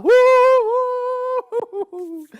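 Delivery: high loud whistle call; high hooting train whistle; train whistle woo. Acted. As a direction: toot like a steam whistle. Gabe blows train whistle.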